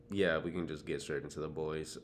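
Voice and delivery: usual speaking voice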